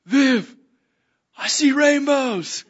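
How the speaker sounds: high, loud and drawn out; excited call. Retold or imitated. Telling her friend about rainbows, excited call. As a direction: call out in hammed-up amazement. Calling friend to share vision.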